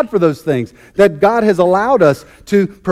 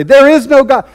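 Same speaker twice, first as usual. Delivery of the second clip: much higher, louder yell; yelled retort; 7 semitones higher, 6 dB louder than usual. Retold or imitated. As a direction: shout it back over the fence. Atheist yells back each morning.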